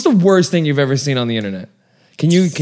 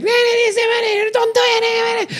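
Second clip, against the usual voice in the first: high drawn-out plea, very slow; pleading lady; much slower than usual. Retold or imitated. Woman in the crowd begging the jumper, high and slow. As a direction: stretch it out high like a desperate woman. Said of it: "you can hear the lady like".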